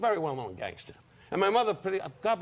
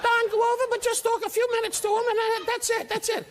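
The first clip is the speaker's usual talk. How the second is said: much higher, thin imitation; high-voiced manager. Comedian imitating his old manager, high and thin. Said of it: "He always talked like this".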